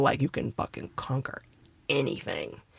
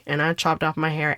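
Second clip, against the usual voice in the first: normal talking voice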